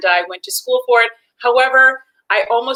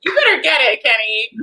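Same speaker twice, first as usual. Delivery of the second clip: higher cheer; cheering the host on, raised; 3 semitones higher than usual. Retold or imitated. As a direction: hype him up. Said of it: "I was like".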